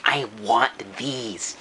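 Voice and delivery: put-on drawn-out, slow childish want; slow greedy kid. Kid eyeing free slips, slow craving. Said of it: "You're like".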